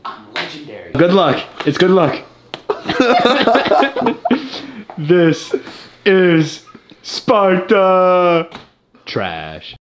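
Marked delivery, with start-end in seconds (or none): 0.9-2.2 excited. Real, not acted. Hyped after the win. 2.5-4.9 loud hyped laughter; high whooping laugh. Real, not acted. Laughing after the win. 5.0-8.5 slow, loud movie-quote shout; Sparta battle cry. Pretend. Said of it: "movie quote shouted".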